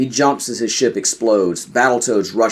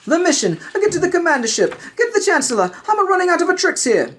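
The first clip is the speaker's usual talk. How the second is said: high, urgent voice of a Jedi in a dogfight; urgent Jedi master. Acted. A Jedi master calling from his crippled fighter, high and urgent. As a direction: cry out orders as your ship falls apart.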